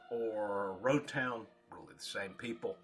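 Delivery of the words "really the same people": ordinary talk, nothing marked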